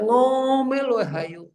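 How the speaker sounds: high, drawn-out mock scolding; imitating high-pitched nagging. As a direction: stretch the nag way up high. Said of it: "Tinggi terus suaranya".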